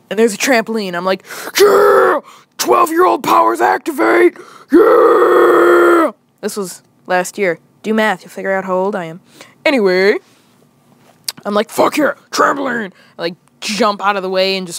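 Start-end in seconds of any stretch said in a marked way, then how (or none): 1.6-6.1 excited shout. Retold or imitated. Hyped kid shouting superpowers. 11.7-12.9 excited. Retold or imitated. Excited about trampoline.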